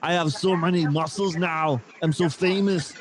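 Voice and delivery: lightly voiced muscleman boast; a hint of a bragging bodybuilder; mock Arnold Schwarzenegger. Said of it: "You'll be talking like this".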